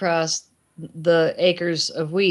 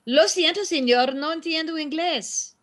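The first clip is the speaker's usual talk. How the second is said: high, innocent Spanish apology; imitating daughter feigning no English; mock naive tourist speaking Spanish; playing dumb in Spanish; 8 semitones higher than usual. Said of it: "I hear my daughter ... say".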